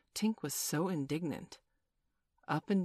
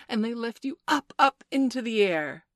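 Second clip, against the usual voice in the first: higher, louder, lifting delivery; enthusiastic explaining; 6 semitones higher, 8 dB louder than usual. A narrator's reading. Peter explains flying to the children, enthusiastically. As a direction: sweep them upward with the words.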